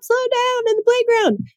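high mock yell of a parent; retold scream. Parent yelling at kids in the playground, high mock scream. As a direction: shriek it like a worn-out parent at the park. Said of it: "So I was screaming at them".

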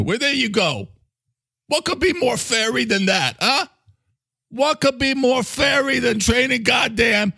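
high, cocky New York character; Mister Coffee. Acted. New Yorker boasting and inviting International by, high and brash.

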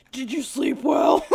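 creepy crying mock question; creepy mock Adam. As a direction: ask it like a creep watching. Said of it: "just like".

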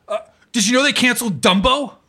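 playing the eager older writer at the elevator; older woman's voice. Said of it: "and she goes".

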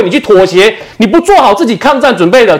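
ordinary talk

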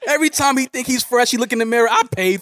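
high teasing voice, gloating; mock boasting tease. Teasing cohost about the clothes he paid for, high gloating tone. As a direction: rub it in that you paid.

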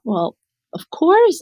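delighted reassurance. Retold or imitated. Retelling her reply to a student.

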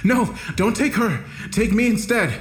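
high-pitched desperate cry; screaming plea. A narrator's reading. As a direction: cry out to draw the guard away.

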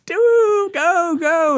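high, mock-yelled cheer; imitating cheering yell. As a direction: egg the dancer on like the party women. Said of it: "they're yelling".

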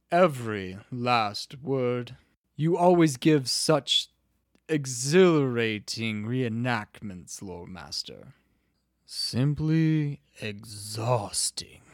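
slow, drawn-out, tired praise; weary flattery. Acted. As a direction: praise him as the worn-out scribe. Weary scribe flatters lore master.

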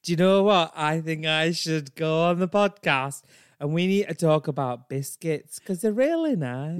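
earnest, pushy Yorkshire woman; Brenda. Acted. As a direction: pitch a silly idea in broad Yorkshire. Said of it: "she has such a strong Yorkshire accent".

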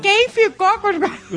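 laughing mock roll call. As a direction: shout the question with a laugh. Repeating the umbrella roll call.

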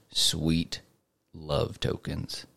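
quiet, slow whisper on love tokens; host teasing listeners with the reward, hushed and slow; hushed sweet. Pretend. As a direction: whisper it like a tempting treat.

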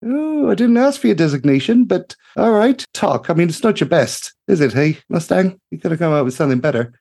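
bright-eyed gnome engineer calling out in delight; gushing gnome mechanic. Acted. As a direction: sing it out like a cheery little tinkerer. Greta teasing Tok's name.

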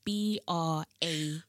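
slow, letter by letter; spelling out. Shown as a demonstration. Woman shows how her surname is written, spelling out. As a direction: space each letter out deliberately. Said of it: "So my name is spelled".

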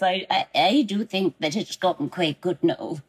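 Maggie Smith-style teacher voice; Professor McGonagall. Acted. Showing the host the practised impression, McGonagall voice. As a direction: put on the film professor's accent, measured and in character. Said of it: "Your Professor McGonagall is so good".

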